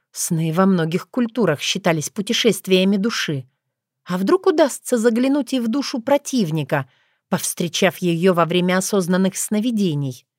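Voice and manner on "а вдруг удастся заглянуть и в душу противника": usual speaking voice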